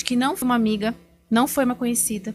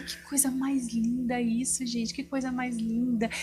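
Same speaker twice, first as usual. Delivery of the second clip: soft, slow, dreamy admiration; encantada, boba; 6 dB quieter, slower than usual. Retold or imitated. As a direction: gush softly and slowly, awestruck. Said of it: "aquela cara de boba". (encantada, baixinho e devagar)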